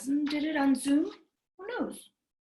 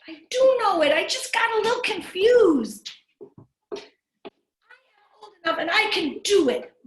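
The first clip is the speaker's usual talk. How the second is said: higher, louder pleading little sister; higher, louder pouty girl; insistent little girl; 8 semitones higher, 6 dB louder than usual. Acted. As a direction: insist like a hurt small child. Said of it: "But Evie insisted".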